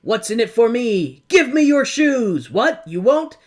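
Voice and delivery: high loud demand; demanding Oz head. Retold or imitated. Oz head demanding what they want, high and pushy. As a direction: bark it like a pushy giant head. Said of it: "as it's like".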